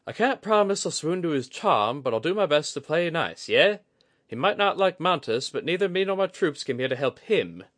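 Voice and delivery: high, loud, grudging promise; Kudaka, exasperated. A narrator's reading. Kudaka grudgingly agrees to play nice for Oguri. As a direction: roll your eyes but agree.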